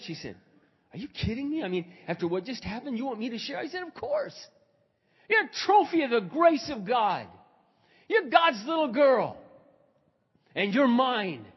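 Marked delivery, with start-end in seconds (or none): 0.9-3.6 retold incredulity. Woman disbelieves his request, retold. 3.8-11.4 high, loud, wholehearted encouragement; emphatic affirmation. Retold or imitated. Pastor telling Sally she is God's trophy, emphatic.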